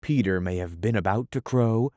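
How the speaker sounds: ordinary delivery, nothing marked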